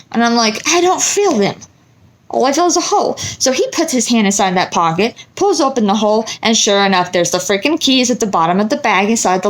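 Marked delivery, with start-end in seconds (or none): 0.7-3.2 much higher, bewildered voice; puzzled insisting. Retold or imitated. Telling husband she feels only a hole, high and bewildered.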